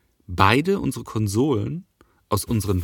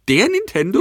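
high disbelieving question; mock shocked at the word; incredulous; 10 semitones higher, 5 dB louder than usual. Real, not acted. Mock outrage at article.

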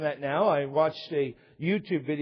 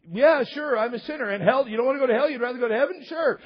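high, loud mock back-and-forth; hammed-up soul-winning script; glib acted exchange; 7 semitones higher, 6 dB louder, faster than usual. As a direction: rattle off eager questions and easy agreement. Retold rapid question and answer exchange.

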